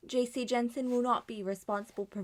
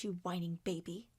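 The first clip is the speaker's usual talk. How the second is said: usual speaking voice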